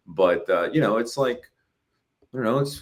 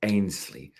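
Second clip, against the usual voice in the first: Herzog narration; 5 dB quieter than usual. Retold or imitated. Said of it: "the narration is Werner Herzog going".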